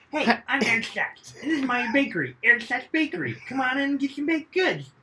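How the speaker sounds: hokey high-pitched advertiser voice; hammed-up pitchman. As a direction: holler a local-ad sales spiel. Voicing the bakery owner's sales pitch.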